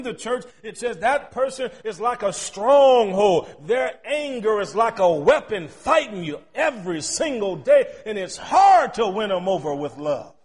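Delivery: loud, driving preacher's cadence; preaching at full force. Acted. Pastor preaching sin to the congregation, full-force preaching.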